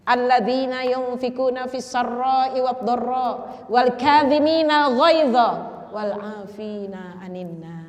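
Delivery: slow, chanted Arabic verse; slow Quran chant; Quran recitation. Real, not acted. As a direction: recite the verse with measured reverence.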